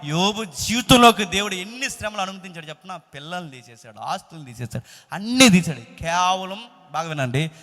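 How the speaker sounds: loud, high preaching building to a shout; forceful preaching. Real, not acted. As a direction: drive the point home to the congregation, loudly. (గట్టిగా, ఉద్వేగంగా ప్రసంగం)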